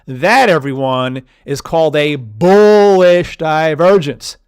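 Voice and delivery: slow and loud, leaning hard on each word; emphatic announcement. Acted. Teacher to viewers, announcing the term slowly and loudly. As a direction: proclaim the term like a big reveal.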